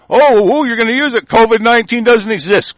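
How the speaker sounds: high-pitched, scornful outburst; exasperated objection. Real, not acted. Speaker interrupting the article he reads, exasperated. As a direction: jump in high, cutting the reading off.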